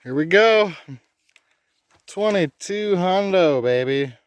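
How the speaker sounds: loud, high cheer; excited cheer. Real, not acted. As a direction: hype up the milestone. Reaching the 2200 mile mark.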